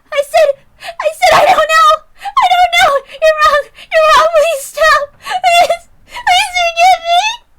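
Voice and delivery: high, piercing, sobbing screams; hysterical crying scream. Acted. Sadako screaming at Keiichi in terror, crying hysterically. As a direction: shriek and beg in total panic.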